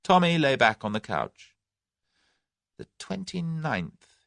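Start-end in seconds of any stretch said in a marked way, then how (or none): 2.8-4.2 softer and slow, musing; thoughtfully. A narrator's reading. Tommy thoughtfully hinting at the date, slow and soft. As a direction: let it hang, slow and soft. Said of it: "he said thoughtfully".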